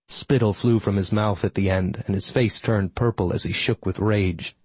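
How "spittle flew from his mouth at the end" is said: ordinary delivery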